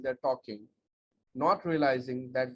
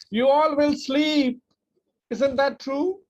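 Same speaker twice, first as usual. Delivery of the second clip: much higher, louder, slow punchy wake-up; energetic burst; 10 semitones higher, 8 dB louder, slower than usual. Shown as a demonstration. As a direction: jolt them awake after the drone. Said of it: "exclude monotone".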